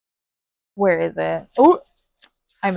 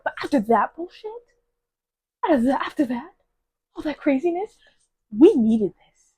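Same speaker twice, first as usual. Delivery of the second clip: 1 exasperated; 6 semitones higher than usual. Real, not acted. Ranting about the book.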